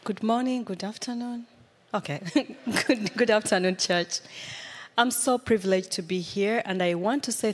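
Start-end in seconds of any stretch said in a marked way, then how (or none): none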